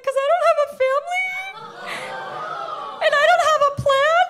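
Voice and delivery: high, loud, fast melodramatic wail; telling the phone clerk she has no family, wailing; dramatic wail. Retold or imitated. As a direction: wail it like a tragedy.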